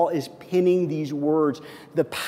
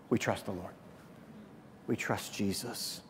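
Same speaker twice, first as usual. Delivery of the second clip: much softer and lower, stressing "We"; hushed affirmation; 6 semitones lower, 17 dB quieter than usual. Acted. Pastor affirming faith with the congregation, hushed. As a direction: say it like a quiet creed.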